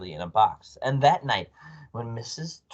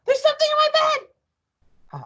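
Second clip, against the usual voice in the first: loud, high, drawn-out shriek; panicked scream; 6 dB louder than usual. A narrator's reading. Wife finding a creature in bed, screaming. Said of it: "she screamed, bouncing about".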